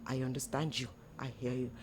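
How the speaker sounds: soft, low and understanding; quiet reassurance to a spouse; gentle tone. Shown as a demonstration. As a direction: speak gently, dropping pitch and volume. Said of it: "say it in a gentle tone".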